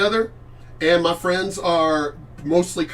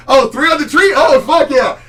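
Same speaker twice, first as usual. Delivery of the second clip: much higher and louder, thrilled to remember; excited outburst; 9 semitones higher, 10 dB louder than usual. Real, not acted. Streamer recalling farm driving, excited shout. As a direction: blurt it out with delight.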